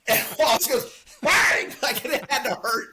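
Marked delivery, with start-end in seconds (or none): 1.2-1.7 high, loud "bang!"; sudden explosive sound effect; imitated hammer blow. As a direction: shout out the impact of the blow. Imitating Wallace's hammer blow in retelling.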